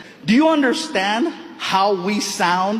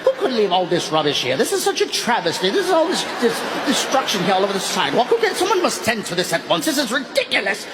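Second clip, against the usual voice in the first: posh British; faster than usual. Retold or imitated. Comedian mocking how Brits sound, posh British accent. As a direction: play a prissy Brit fussing over litter. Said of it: "It sounds amazing".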